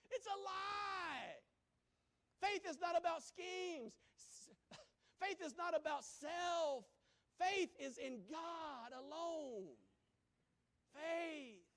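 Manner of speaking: loud, slow, emphatic preaching. Acted. Preacher to congregation, slow loud forceful preaching. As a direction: hammer it home slowly, full force. Preaching climax against scams.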